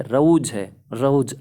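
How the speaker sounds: रौज with an au diphthong, not रो; diphthong au sound. Shown as a demonstration. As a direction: stretch the औ in रौज. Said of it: "औ की sound आ रही है". (रौज में औ की ध्वनि, रो नहीं)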